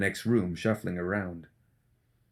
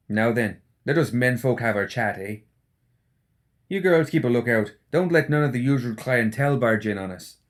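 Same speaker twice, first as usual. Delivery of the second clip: louder, higher swaggering orders; Seamus, bossy host; 3 semitones higher, 4 dB louder than usual. Acted. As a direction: boss the girls around cheerfully. Seamus voiced.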